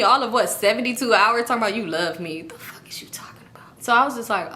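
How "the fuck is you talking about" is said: baffled whisper; voiceless, hushed bewilderment; whispered disbelief. Retold or imitated. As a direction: whisper it as an incredulous aside. Retold disbelief, muttered aside.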